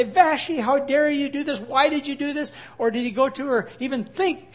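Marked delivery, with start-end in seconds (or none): 0.0-2.5 a husband's angry rebuke; indignant demand. Pretend. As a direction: scold her sharply, asking how she dared. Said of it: "Does he go and say".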